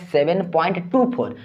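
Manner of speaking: "टू four" as two separate digits; digit-by-digit reading. Shown as a demonstration. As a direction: give "टू" and "four" one at a time. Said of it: "एका करके पढ़ा जाता है". ("टू four" एक-एक अंक करके)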